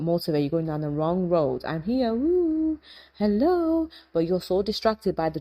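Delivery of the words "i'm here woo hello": a much higher, slow, sing-song "woo, hello"; calling out. Pretend. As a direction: wave someone down from afar. Soul calling out for attention.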